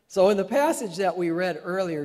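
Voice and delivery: ordinary talk, nothing marked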